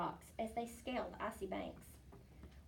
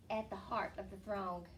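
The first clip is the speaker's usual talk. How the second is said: ordinary delivery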